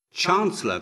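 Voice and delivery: high, loud urgent call; crying out. A narrator's reading. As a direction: cry out to the chancellor. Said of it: "cried Horus".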